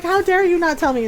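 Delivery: mildly indignant scolding; mock mad. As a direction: imitate an offended friend. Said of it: "they were mad".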